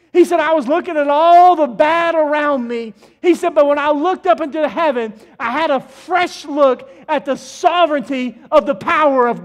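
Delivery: loud, rising sermon cadence; full-force preaching. Acted. Pastor thundering Isaiah's vision to the congregation, full preaching. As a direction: preach it out to the whole sanctuary.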